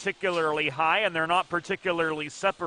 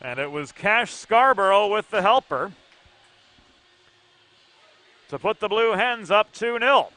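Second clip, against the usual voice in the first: rising, louder goal call; excited goal call; 5 semitones higher, 6 dB louder, slower than usual. Real, not acted. Caller to viewers as the Hens score, excited. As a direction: lift it as the goal goes in.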